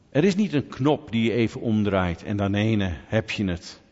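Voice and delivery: ordinary talk, nothing marked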